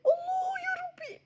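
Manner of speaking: high, slow, hammed-up crying wail; mock wail. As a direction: wail it like a comic lament. Sister wails in mock despair.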